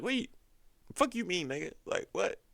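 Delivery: very high falsetto disbelief; hammed-up squeaky confusion; mock incredulity. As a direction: imitate a baffled mom questioning her son. Acts mom baffled by son's denial.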